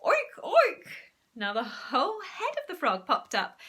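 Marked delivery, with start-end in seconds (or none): 0.0-0.9 high, loud pig oinks; confident oinking. Acted. Zoo owner, getting the hang of it, oinks at the frog. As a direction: oink with gusto now. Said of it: "getting rather the hang of it".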